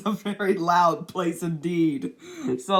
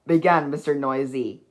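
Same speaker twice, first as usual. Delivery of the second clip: plain reading voice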